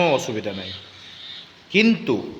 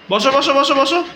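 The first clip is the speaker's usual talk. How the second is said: coaching calls; 6 semitones higher, faster than usual. Real, not acted. Coaching kids at leg day.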